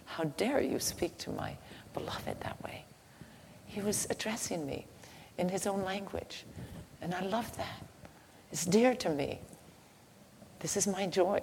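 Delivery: soft, whispered, loving rebuke; understated voice of God, soft and fond; tender divine reproach. Acted. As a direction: defend the beloved gently, almost in a whisper.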